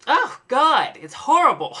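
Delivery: loud, high groan of disgust; disgusted outcry. Real, not acted. Gagging at the fridge smell, a loud high disgusted cry. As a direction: recoil from the stench out loud.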